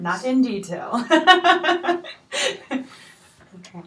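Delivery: laughing through the words. Real, not acted. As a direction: cracks up, then recovers. Therapist laughs at blunt answer.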